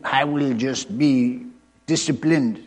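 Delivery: put-on slow, deliberate Eastern European accent. Preacher voicing a stern vow, Eastern European accent. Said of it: "say it in an Eastern European accent".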